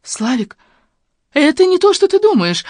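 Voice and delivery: high, loud panicked pleading; frightened woman protesting. A narrator's reading. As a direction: plead in alarm, voice shooting up. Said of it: "Жанна испуганно прижала ладошки".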